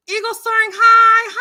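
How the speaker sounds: high, loud call-out; excited greeting. Real, not acted. Host welcoming a viewer to the live, excited greeting. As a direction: sing out the name high and loud.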